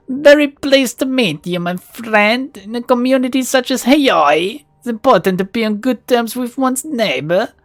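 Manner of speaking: put-on Russian-accented shopkeeper introducing his drone; cultured Russian accent. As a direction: introduce your drone proudly, Russian-style. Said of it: "There's a hint of a Russian accent".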